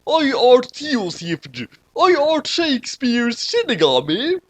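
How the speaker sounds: high, slow, grand fake-olde voice; high theatrical shinigami voice; mock Shakespearean shinigami. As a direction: proclaim it like a ham actor in Shakespeare.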